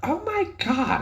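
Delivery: high mock gasp of "Oh my God"; shocked mom. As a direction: imitate mom's horrified squeal. Mom reacting to tattoo.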